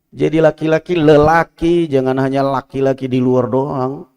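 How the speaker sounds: normal talking voice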